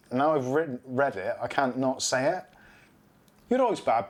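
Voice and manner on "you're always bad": put-on Brummie accent. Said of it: "I'm not gonna do the Brummie accent".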